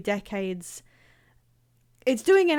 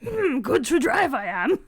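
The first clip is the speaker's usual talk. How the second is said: Yoda. Acted. Streamer carrying on the joke for viewers, Yoda impression. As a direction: put on a comic little creature voice. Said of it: "because of the Yoda voice".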